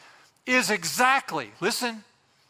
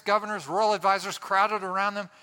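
ordinary talk, nothing marked

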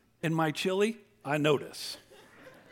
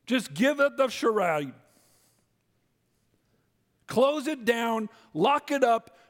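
preaching at full force; 9 semitones higher than usual. Real, not acted. Preacher calling to shut down.

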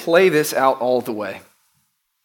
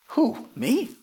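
incredulous; 9 semitones higher, 6 dB quieter than usual. Acted. Saul's doubting reply to Samuel.

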